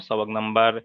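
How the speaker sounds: normal talking voice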